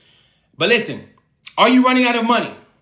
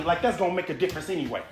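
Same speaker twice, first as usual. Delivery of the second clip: usual speaking voice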